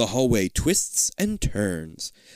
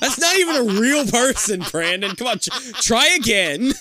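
high, loud, real laughing disbelief; laughing self-mockery; 9 semitones higher, 6 dB louder than usual. Real, not acted. Reader mocking a slip about the author's name, laughing. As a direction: scold yourself through laughter.

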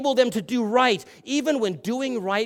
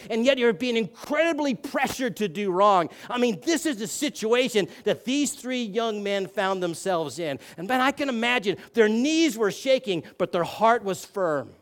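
ordinary talk

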